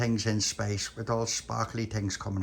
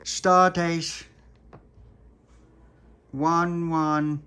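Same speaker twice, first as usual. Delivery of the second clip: loud, high, drawn-out announcing, false start; captain's log announcement; 5 semitones higher, 6 dB louder, much slower than usual. Acted. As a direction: boom the log date, then stumble.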